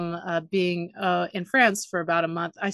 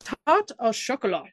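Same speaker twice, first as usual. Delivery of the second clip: French pronunciation; 4 semitones higher than usual. Retold or imitated. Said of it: "he was like".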